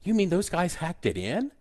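high, incredulous question; playful mock astonishment; retold amazement. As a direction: pitch way up, acting out disbelief. Acting out his amazed reaction.

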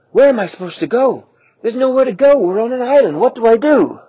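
high, loud frantic cries; panicking. Acted. The T-Rex mom panicking over where to take her eggs.

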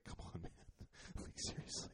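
very quiet, voiceless retold plea; barely voiced exasperation; hushed disbelief. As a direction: imitate a stunned boss under the breath. Let-down employers reacting to mistake.

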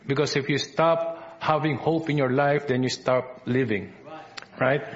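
ordinary talk

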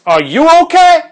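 very high, loud mock shout; imitated confrontational yell. Big lady snapping back at the bystander, confrontational yell. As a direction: yell it as an aggressive challenge.

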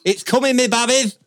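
very high, loud caricature; impression voice. Podcast guest doing his party-piece impression, high and loud. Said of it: "now is the time for your impression".

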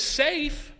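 high disbelieving echo; incredulous. Retold or imitated. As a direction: repeat the word as if it's absurd. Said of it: "looks at them incredulously and says".

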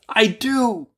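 high-pitched merchant voice; merchant's answer. Acted. Merchant swears to the genie, high character voice. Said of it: "answered the merchant".

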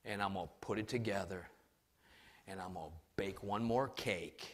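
low, soft, fading to a whisper; despairing widow. Retold or imitated. Widow telling the prophet she will die, soft and sinking. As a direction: confess it hopelessly, sinking to nothing. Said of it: "said to him".

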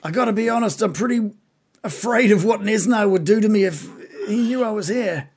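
high, uneasy creature voice; frightened bugbear. Acted. Captive bugbear afraid of what Nesna would do, uneasy.

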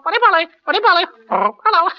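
high squawking parrot voice. Shown as a demonstration. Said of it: "once I was called upon to do a parrot".

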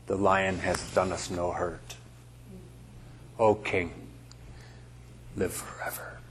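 low, soft, spaced-out words; hushed. Acted. Believers greeting their King after death, hushed and reverent. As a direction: let it sink softly, pausing between phrases.

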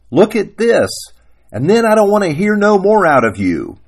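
high, forceful acted demand; loud, high-pitched story-character order; demanding husband. Acted husband challenging his wife.

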